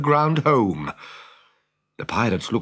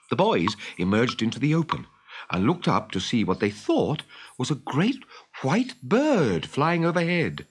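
ordinary delivery

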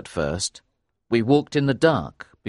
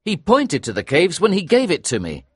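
higher-pitched, animated dialogue; eager realisation; 7 semitones higher than usual. A narrator's reading. Narrator adding a clue about the caves, eager. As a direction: chime in eagerly. Narrator joins the excited deduction.

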